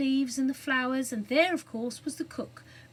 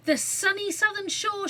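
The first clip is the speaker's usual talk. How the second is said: high, louder excited cry; cried out; 10 semitones higher, 5 dB louder than usual. A narrator's reading. Jane crying out her plan to the others, excited high cry. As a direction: burst out with the idea. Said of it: "she cried".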